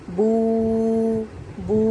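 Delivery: बू said with a pure ऊ; बू held even, not jerked; long oo sound. Shown as a demonstration. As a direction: round the lips for बू, oo not o. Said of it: "ऊ का sound हमारा निकलेगा".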